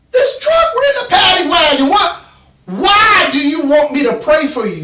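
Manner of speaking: hammed-up disbelief shout; yelling at the guy in the wagon; outraged yell. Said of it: "I said".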